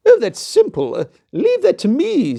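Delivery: high, loud confident queen. Acted. Queen reassuring the king, high and confident. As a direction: brush it off like a proud queen.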